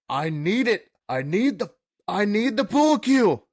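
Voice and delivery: rising to a high, loud plea; mock drunk demand. Quoting friend demanding pool cue.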